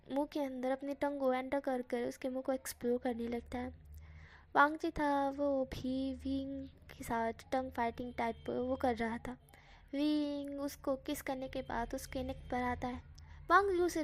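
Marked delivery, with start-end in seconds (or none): none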